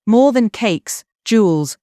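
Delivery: ordinary delivery, nothing marked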